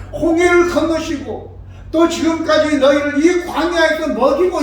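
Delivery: high, forceful preaching plea; anguished loud appeal; impassioned reproach. Acted. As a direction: pile up each mercy with rising urgency. Acts Moses' impassioned reproach to people.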